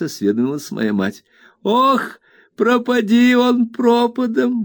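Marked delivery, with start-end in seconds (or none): 1.6-4.6 put-on high, slow exasperated cry of an old nurse; exclaiming Peggotty. A narrator's reading. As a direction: throw up her hands, pitched way up. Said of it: "воскликнула Пегати".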